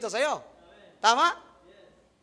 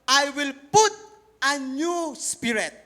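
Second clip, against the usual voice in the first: solemn scripture proclamation; scripture reading; 2 semitones higher, 6 dB louder, slower than usual. Acted. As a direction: proclaim the verse slowly. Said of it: "Old Testament to".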